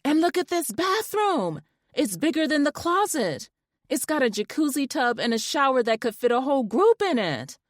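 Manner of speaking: high, giddy gushing; excited scream. A narrator's reading. Wife gushing to friend about the bathroom, thrilled. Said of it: "screamed even louder".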